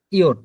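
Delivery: ইয়ট said as German Jot, a yot sound, not English jay; German letter name. Shown as a demonstration. Teacher contrasting ইয়ট with English জে. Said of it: "আমরা এটাকে ইংরেজিতে জে বলতাম".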